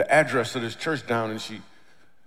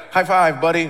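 loud, higher mock-chummy announcement; 5 semitones higher, 10 dB louder than usual. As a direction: announce it like an overeager buddy, imitated.

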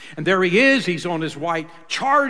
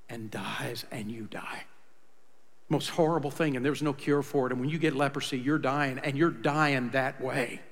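hushed grim telling; 5 semitones lower, 9 dB quieter than usual. Acted. Grim description of leprosy death.

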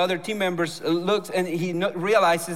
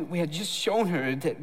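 normal talking voice

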